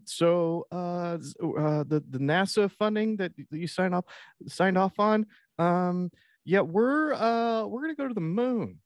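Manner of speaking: high, halting dorky voice full of ums; nervous underling. Acted. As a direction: stumble through bad news to the boss. Scott nervously briefs the president.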